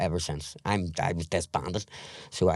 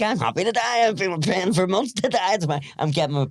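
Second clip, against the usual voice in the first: high, louder retold resignation; imitating his old self; 12 semitones higher, 4 dB louder than usual. To friend Bobby about wanting to die, high and louder. Retelling deathbed talk with friend.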